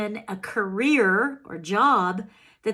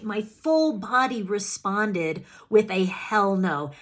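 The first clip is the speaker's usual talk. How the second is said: usual speaking voice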